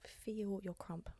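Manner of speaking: breathy whispered sign-off; whispering. Acted. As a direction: whisper it slowly.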